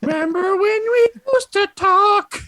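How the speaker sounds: high loud puppet squeak; squeaky puppet voice. Pretend. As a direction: squeak it fondly.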